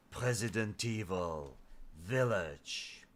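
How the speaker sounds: deep, quiet trailer announcer voice; movie trailer voice. Pretend. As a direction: growl it like a horror game trailer. Resident Evil trailer parody.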